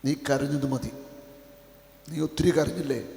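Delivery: tender consolation. Acted. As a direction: speak softly to each hurting soul. Soft consoling sermon voice. (മൃദുവായ ആശ്വാസം)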